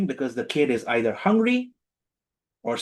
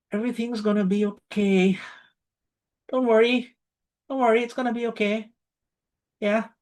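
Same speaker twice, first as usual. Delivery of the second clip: much higher, sing-song comforting; version one, high reassurance; 10 semitones higher than usual. Shown as a demonstration. Soothing someone worried about money, in a high sing-song voice. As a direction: coo it up high like soothing a child. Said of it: "Version one".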